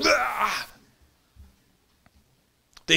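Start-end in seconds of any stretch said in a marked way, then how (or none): 0.1-0.7 mock scream. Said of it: "And it's like".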